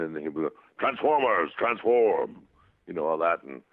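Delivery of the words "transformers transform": higher, ringing Transformers command; Optimus toy voice. Retold or imitated. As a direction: imitate the toy's battle call. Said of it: "the voice, you know, when you push a button".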